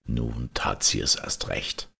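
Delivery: ordinary delivery, nothing marked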